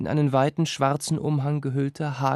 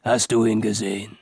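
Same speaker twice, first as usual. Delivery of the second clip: lower, hollow death voice; grave voice; 4 semitones lower, 4 dB louder than usual. Acted. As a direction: ask it like the grim reaper. The Ankou keeps his grave voice.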